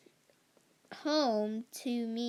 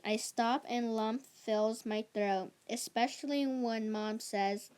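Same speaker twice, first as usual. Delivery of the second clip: ordinary delivery, nothing marked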